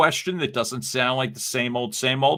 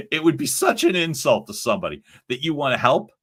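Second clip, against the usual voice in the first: ordinary delivery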